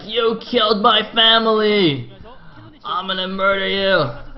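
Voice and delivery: hammed-up drawn-out high cry; slow, loud, melodramatic vow of revenge; mock vengeful rage. Probe chasing the killer Zergling, mock-furious. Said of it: "He's like".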